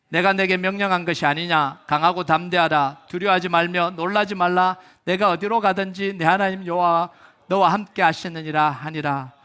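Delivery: loud, measured verse reading; scripture recitation. Real, not acted. Pastor leading congregation through the closing verse, loud scripture recitation. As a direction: read the verse out firmly for all to join.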